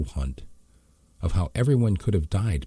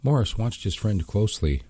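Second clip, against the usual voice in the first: usual speaking voice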